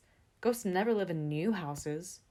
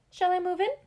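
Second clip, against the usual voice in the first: very high squeaky little voice; mouse voice; 12 semitones higher, 9 dB louder than usual. Acted. Mouse asks Gus, high squeaky voice.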